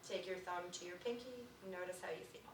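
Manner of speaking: soft, quiet meditation voice; guided meditation. Acted. Teacher guiding the class through finger touches. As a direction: guide them softly through each finger.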